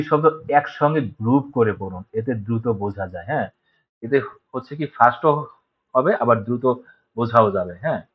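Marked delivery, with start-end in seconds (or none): none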